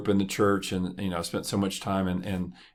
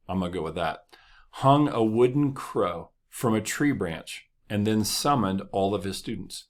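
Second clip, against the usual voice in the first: ordinary talk, nothing marked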